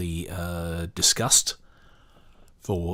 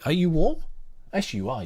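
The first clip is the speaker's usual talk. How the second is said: high, doting question to a pet; pet talk; 11 semitones higher, 5 dB louder, faster than usual. Real, not acted. To the pet curled up in the fluffy reading blanket, a high doting coo.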